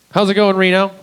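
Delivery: loud, high greeting to the room; loud crowd greeting; calling out to the crowd. Real, not acted. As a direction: call out to the whole club.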